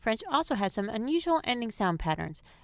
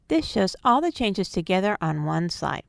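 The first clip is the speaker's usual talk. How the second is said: ordinary talk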